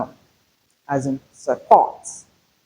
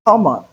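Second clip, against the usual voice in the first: Common with Ko raised and full O; stress on first syllable; 5 semitones higher, 3 dB louder than usual. Shown as a demonstration. Teacher repeats Common to show the stressed first syllable. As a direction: hit Ko firmly in Common. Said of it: "in common, the syllable is stressed".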